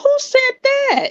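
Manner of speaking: very high-pitched, incredulous celebrity impression; Portia Williams. Co-host doubting the relationship claim, mock-outraged impression. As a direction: question it in a squeaky, put-on voice. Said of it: "in my Portia Williams voice".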